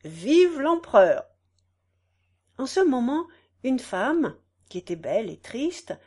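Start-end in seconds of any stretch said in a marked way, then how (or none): 0.0-1.2 slow, declaimed reply; drawn-out campaign slogan; candidate's echo. A narrator's reading. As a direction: echo the cheer as an orator. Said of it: "le candidat répondit".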